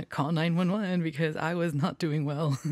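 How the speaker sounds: real laughter breaking into the words; laughing. Real, not acted. Host admitting a rough week to viewers, laughing.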